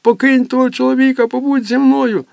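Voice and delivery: very high, loud and fast; desperate plea. Acted. Boy begging mother to stay, desperate loud. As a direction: beg her loudly, almost screaming.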